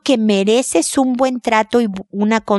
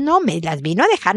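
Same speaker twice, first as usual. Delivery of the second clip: usual speaking voice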